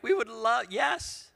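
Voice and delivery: high-pitched, delighted yes; eager agreement. Acted. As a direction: jump at the request, voice leaping up. Acts pastors' eager answer.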